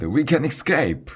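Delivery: high hopeful call; rally your friend to run; excited cry. A narrator's reading. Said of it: "he cried".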